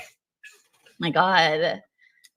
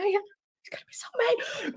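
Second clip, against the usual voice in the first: slow hushed whisper; 4 semitones higher, 8 dB quieter, slower than usual. Real, not acted. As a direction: whisper so he won't hear. Repeats it hushed, husband sleeping.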